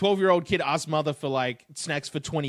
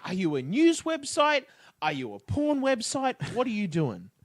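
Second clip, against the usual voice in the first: high incredulous questioning; 7 semitones higher than usual. Retold or imitated. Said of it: "I'm like".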